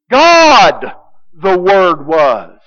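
God stretched long and loud, the rest falling away; slowly, with heavy weight on "God"; stress on God. Shown as a demonstration. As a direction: hit God hard, then let "the Word was" drop. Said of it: "God is the emphasis".

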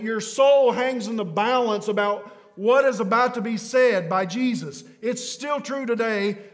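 loud, high, fervent sermon delivery; preaching at full force. Acted. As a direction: press every line on the crowd like eternity hangs on it.

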